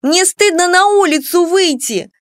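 high, loud outburst of a daughter; angry shout. A narrator's reading. Daughter screaming at her mother in shame, angry shout. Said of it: "кричала в лицо матери Клара".

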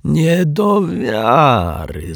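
high, hugely drawn-out mock disbelief; theatrical exaggeration. A narrator's reading. Rogue finds the second wallet, theatrically amazed. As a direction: overact it, stretching every vowel. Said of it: "zawołał z teatralną przesadą".

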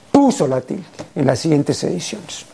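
usual speaking voice

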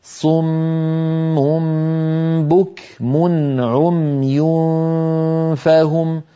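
صم بكم عمي فهم recited slowly, lips rounding on each damma; Quranic recitation. Shown as a demonstration. Teacher reciting the verse to show lip rounding. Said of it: "لاحظوا هذا المقطع العجيب".

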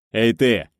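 loud rough shout; a bully's loud call; yell. A narrator's reading. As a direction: bark it across the yard. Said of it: "заорал парниша".

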